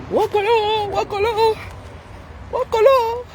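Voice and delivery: squealing with delight, high pitched; high joyful squeal. Real, not acted. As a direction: shriek it out happily. Cri aigu de joie. (cri de joie aigu)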